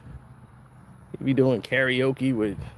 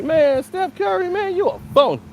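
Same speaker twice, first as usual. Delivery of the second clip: outraged fan. Retold or imitated. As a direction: drag out the insult like a fed-up fan. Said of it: "You gonna be".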